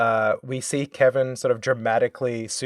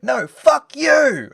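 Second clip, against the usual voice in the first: yelled retort; 13 semitones higher, 7 dB louder, much slower than usual. Retold or imitated. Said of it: "It's like".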